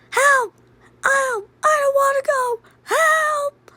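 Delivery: very high, wailing plea; panicked cries for help. Acted. Boy being driven to the woods, wailing in panic. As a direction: wail for help in a squealing high pitch.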